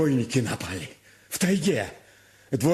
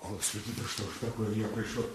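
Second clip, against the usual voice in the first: quiet low muttering; grumbling under breath; 6 semitones lower, 12 dB quieter than usual. Acted. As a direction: mutter it to yourself while leaving. Servant grumbling as he leaves.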